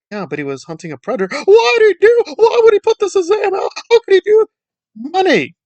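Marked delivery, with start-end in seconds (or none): 1.5-5.6 very high, loud mock-outraged squeal; mock hysteria. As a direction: ham up the horrified critic. Mocking outraged critics.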